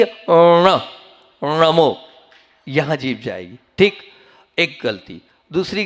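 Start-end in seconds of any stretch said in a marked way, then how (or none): 0.0-0.8 अणा with the tongue pulled back, not on the teeth, said firmly; retroflex ṇa demo. Shown as a demonstration. Monk correcting the crowd's अणा, tongue curled back. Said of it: "जीभ कहाँ जाएगी".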